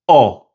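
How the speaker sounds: "O" short and rounded, as in not. Shown as a demonstration. Teacher repeating the vowel for learners, short "O". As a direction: say "O" as one pure vowel, no glide. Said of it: "the same short O sound".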